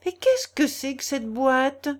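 high, loud, inquisitive question; excited curiosity. A narrator's reading. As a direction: pounce on a new discovery.

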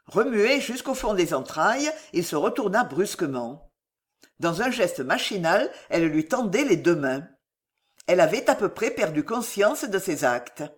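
ordinary delivery, nothing marked

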